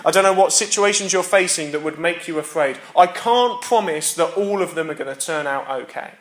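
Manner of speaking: ordinary talk, nothing marked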